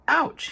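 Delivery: high yelp of sudden pain; a quick squeal as the quills prick; sharp ouch. A narrator's reading. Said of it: "he cried".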